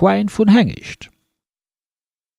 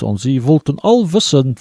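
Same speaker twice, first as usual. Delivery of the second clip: plain reading voice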